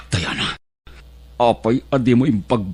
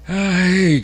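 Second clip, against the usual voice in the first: surprised ay; 10 semitones higher, 5 dB louder than usual. Acted. Father reacts to daughter's ambition.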